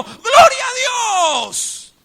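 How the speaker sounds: very loud, high and slow; startled cry of praise. Retold or imitated. Startled woman finally hearing it, crying out loud. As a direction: burst out in shocked praise.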